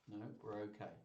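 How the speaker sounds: usual speaking voice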